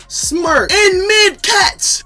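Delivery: high, loud, drawn-out; outraged emphasis. Retold or imitated. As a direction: stretch it, outraged. Outraged recollection.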